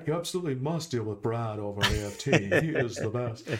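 lightly put-on snooty socialite; a hint of an old-money lady; Thurston Howell's wife. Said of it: "channeling the spirit of Thurston Howell's wife".

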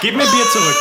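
shout. Real, not acted. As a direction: yell to get the beer back. Beer being taken.

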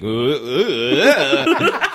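badly acted dumb grunts; mock a terrible actor's groaning; bad-actor groans. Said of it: "this guy who cannot act".

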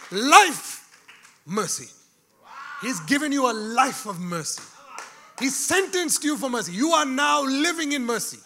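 loud, rising sermon cry; preaching shout. Real, not acted. Preacher telling the church they live in mercy, shouting. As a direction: build it into a shout.

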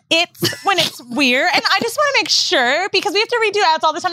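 high-pitched mock caller, words stretched out; Mandy. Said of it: "Then she calls our producer".

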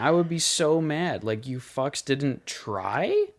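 real outrage, ending on an incredulous question; aggressive. Real, not acted. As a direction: sound mad on the parents' behalf. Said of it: "that's a bit aggressive".

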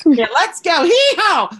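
very high, loud hammed-up cowboy yell; mock cowboy. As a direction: whoop like a cartoon cowboy. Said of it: "coming back saying".

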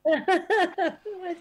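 laughing it off, bashful; a bright embarrassed laugh into the word. Real, not acted. Guest laughing off the host's compliment, bashful laugh.

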